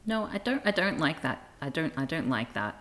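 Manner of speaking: normal talking voice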